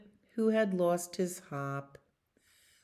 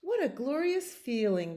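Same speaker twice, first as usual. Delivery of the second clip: bleating goat; 9 semitones higher than usual. Acted. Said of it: "bleated Goat".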